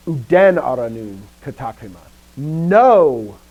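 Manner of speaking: loud stretched emphasis landing on "Know"; emphatic quoting, peaking on a drawn-out "Know"; fronted emphasis. Shown as a demonstration. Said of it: "the emphasis of none, which Paul fronts".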